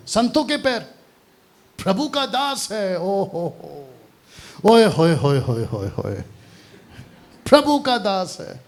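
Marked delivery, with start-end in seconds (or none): 1.8-3.0 high-pitched imitation of an adoring devotee; mock reverence. Mimicking devotees revering saints. 3.0-6.1 cooing string of admiring oohs, parodied; mock fawning. Mimicking devotees fawning over saints. 7.5-8.6 high-pitched imitation of an adoring devotee; mock reverence. Mimicking devotees revering saints.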